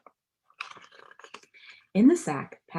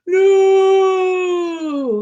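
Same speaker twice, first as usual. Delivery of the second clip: high, much louder panicked yell; 13 semitones higher, 11 dB louder than usual. A narrator's reading. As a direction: shout to stop the slide. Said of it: "yelled the man".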